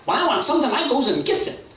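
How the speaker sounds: high, quick, boastful bird; Berchicken Hawk. Acted. Hawk boasts to the patient buzzard, high cocky voice. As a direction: brag in a high squawky voice. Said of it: "Berchicken Hawk says".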